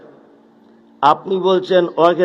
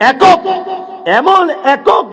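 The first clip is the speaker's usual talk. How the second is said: emphatic call; 9 semitones higher, much slower than usual. Real, not acted. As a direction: hammer the answer home. Preacher drilling the answer.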